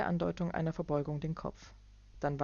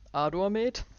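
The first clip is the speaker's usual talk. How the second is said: loud, higher, grinning query; knowing businessman; 5 semitones higher, 6 dB louder than usual. Acted. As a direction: tease with a knowing grin. Clark's voice.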